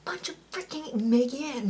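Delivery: ordinary delivery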